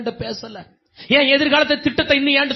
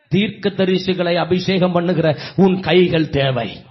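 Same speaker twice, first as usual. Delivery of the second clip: ordinary talk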